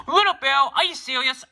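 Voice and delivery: high, loud disbelieving cry; a principal who can't believe it; shocked principal. Acted. Principal shocked at Bill's answer.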